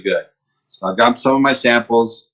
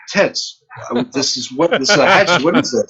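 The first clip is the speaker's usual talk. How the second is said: higher-pitched, mock-baffled objection; retold incredulous protest; 5 semitones higher than usual. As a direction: act out your baffled complaint.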